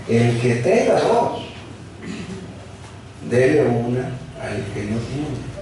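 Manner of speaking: "El que tenga dos" drawn out slowly, every word weighed; slow down and lean on "dele una al que no tiene"; slow emphatic reading. Shown as a demonstration. Verse repeated slowly for emphasis. (lento y enfático, recalcando "dele una")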